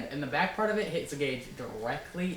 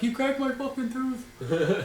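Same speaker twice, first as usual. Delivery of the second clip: whiny hurt friend; 11 semitones higher, 3 dB louder, slower than usual. Retold or imitated. Injured friend's retold complaint.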